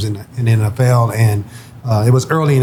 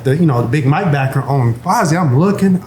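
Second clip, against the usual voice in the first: ordinary delivery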